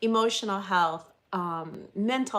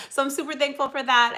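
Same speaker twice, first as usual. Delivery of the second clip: ordinary talk, nothing marked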